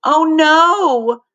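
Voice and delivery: high, loud wailed oh no; girl wailing at the emptied trash. Acted. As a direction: wail out in dismay. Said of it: "she wailed".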